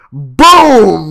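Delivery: loud, high mock explosion; shouted boom like a blast. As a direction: belt out a cartoon explosion boom. Explosion in the retold scene.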